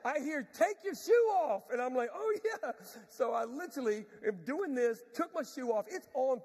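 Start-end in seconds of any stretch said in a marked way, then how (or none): 0.5-1.7 high, loud, slow holler; retold yell, each word stretched. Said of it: "and she yells". 2.1-2.7 sudden realisation. Retold or imitated. Acts out his sudden realisation.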